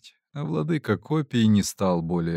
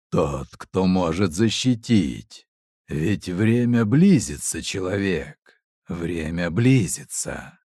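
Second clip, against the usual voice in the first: echoing ethereal energy being; 3 semitones lower than usual. A narrator's reading. Goddess's warning in dream.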